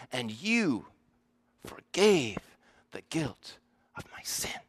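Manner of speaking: gently, trailing into a whisper on "the guilt of my sin"; soft hush. Shown as a demonstration. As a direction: let "forgave" fall softly, then barely breathe "the guilt of my sin". Soft contrast before the emphatic version.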